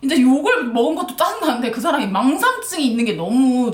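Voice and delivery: usual speaking voice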